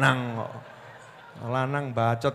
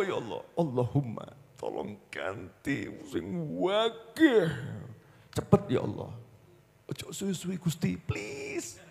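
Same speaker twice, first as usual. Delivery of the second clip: coaxing prayer; 5 dB quieter than usual. Retold or imitated. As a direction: sweet-talk God, half under your breath. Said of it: "ngerayu tuh sama Allah". (merayu Allah dengan suara pelan dan manja)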